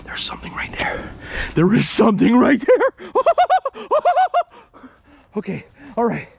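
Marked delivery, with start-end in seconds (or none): 0.0-1.1 real whisper, quick; hushed, hurried discovery. Real, not acted. Spots something in the dark. 1.5-3.1 loud excited repeat, rising at the end; full-voiced thrilled announcement. Real, not acted. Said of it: "I just got excited". 3.2-4.4 high-pitched giddy laugh; thrilled high cackle; excited laugh. Real, not acted. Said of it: "I just got excited".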